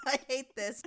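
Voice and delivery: groaning through laughter; laughing. Real, not acted. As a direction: laugh out the complaint. Laughing while complaining.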